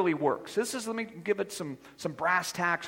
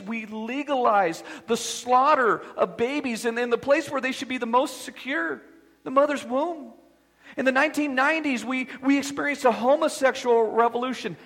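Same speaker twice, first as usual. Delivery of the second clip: preaching crescendo; 4 semitones higher, 4 dB louder than usual. Acted. Preacher driving home judgment to congregation, building to a thunder. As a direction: rise to full preaching force. Preaching rising to full force.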